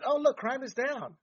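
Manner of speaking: high, sarcastic mimicry of smug officials; a mild mock of elites' cheerful boast; mocking quote. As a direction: sneer it like a fake announcement.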